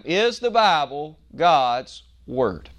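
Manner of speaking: very slow, Is the Bible God's Word spaced word by word; slow deliberate repeat, each word weighted; every word stressed. Shown as a demonstration. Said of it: "Now I'll say that one more time".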